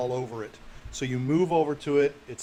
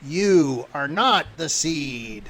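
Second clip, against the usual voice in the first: slow, loud creature proclamation; booming, eerie denial; accusing plant creature; 6 dB louder, much slower than usual. Acted. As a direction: draw out every word with force. GM voices the plant faces.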